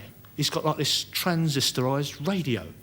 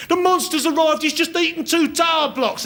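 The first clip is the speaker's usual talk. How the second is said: high, loud frantic cry of a terrified bystander; panicked yell; 14 semitones higher, 6 dB louder than usual. Retold or imitated. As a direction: shout it like the monster is right behind you. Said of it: "some poor punter comes running and says".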